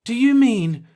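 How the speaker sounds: high, loud, shocked question; aghast. A narrator's reading. Old man asks the Earthman in horror, aghast. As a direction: recoil in horror at the idea.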